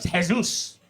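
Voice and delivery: "Jesus" said the Spanish way, as "Hesús"; "Jesus" with Spanish h and stress on the last syllable; Spanish pronunciation. Shown as a demonstration. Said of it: "Jesus is fucking cool in Spanish".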